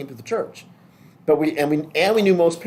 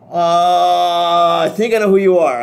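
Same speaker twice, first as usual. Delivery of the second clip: loud drawn-out "uh", higher-pitched bluff; mock stalling; 3 semitones higher, 8 dB louder, slower than usual. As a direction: stall while hunting for the name. Acts out stalling over a forgotten name.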